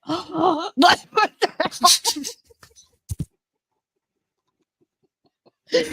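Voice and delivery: high, laughing disbelief; shocked quote. Retold or imitated.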